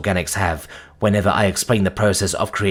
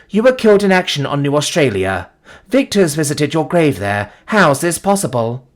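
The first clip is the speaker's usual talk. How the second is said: raised, disbelieving challenge; insistent demand; 5 semitones higher, 3 dB louder than usual. A narrator's reading. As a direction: press her for an explanation, pitched higher. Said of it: "I demand of the red-headed girl".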